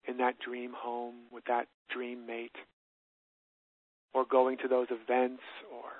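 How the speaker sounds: calm, unhurried, with long pauses for breath; guided meditation. Shown as a demonstration. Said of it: "I'll take 'em through a short version".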